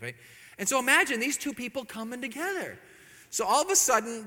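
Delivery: usual speaking voice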